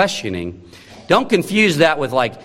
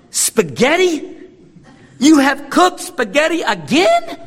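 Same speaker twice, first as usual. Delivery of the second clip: put-on high, outraged child's squeal, drawn out slowly; a five-year-old accusing mom over dinner; indignant little boy; 12 semitones higher than usual. A five-year-old appalled at the spaghetti on his plate, high indignant squeal. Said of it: "it was not a question".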